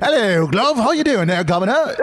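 high, hammed-up Cockney greeting; over-the-top Cockney. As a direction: put on a daft Cockney to embarrass your kid. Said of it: "most over-the-top Cockney accent".

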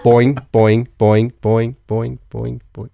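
spoken bouncing "boing", fading away; kangaroo bounces. Pretend. Host imitating a kangaroo hopping away, bouncy. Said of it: "That's a kangaroo".

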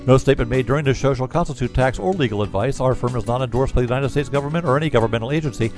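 rapid-fire compliance read; fast legal disclaimer. Acted. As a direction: rattle off the disclaimer quickly. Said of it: "recorded disclaimer read".

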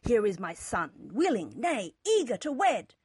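loud, indignant paternal rant; father's shout. A narrator's reading. Father complaining to Rathbone about caprice, shouting. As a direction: rail loudly at the other father. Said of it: "Father shouted".

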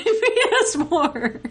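wrestling promo. Retold or imitated. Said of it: "I like your wrestling voice".

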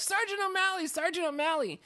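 high-pitched, urgent call for the policeman; acted frantic calling. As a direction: call out in mock panic. Acted urgent plea to arriving policeman.

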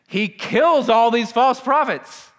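loud, high, drawn-out exclamation; incredulous climax. Real, not acted. Preacher recapping Elijah's victory to the church, incredulous climax. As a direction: belt it out slowly in amazement.

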